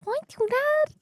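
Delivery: imitating streamer. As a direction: squeak it like a cartoon girl. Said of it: "she was all like that".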